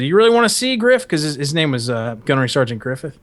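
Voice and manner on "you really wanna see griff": mock taunt. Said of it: "Master Guns goes".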